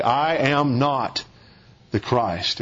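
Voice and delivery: very slow "I am not", falling low on "the Christ"; emphatic denial. Shown as a demonstration. Preacher voicing John denying he is Messiah, slow emphatic. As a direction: hold "not", then sink "the Christ" low.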